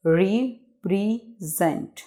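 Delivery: Represent slowly as re, pre, sent; three spaced beats on represent; broken into syllables. Shown as a demonstration. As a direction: pause after each syllable of represent. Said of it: "इसको pronunciate कर लेते हैं".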